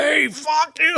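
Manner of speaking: high-pitched echo of the buddy's outburst; mock scream. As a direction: playfully re-enact the snap. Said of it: "screamed at this guy".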